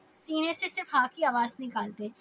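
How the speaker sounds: ordinary talk, nothing marked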